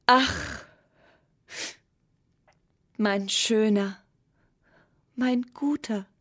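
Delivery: mild tearful lament, slow; a touch of weeping, drawn out. A narrator's reading. Moon weeping over the tiny pencil, mildly tearful. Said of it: "weinte der Mond".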